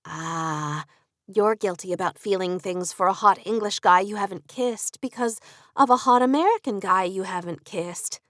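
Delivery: ordinary delivery, nothing marked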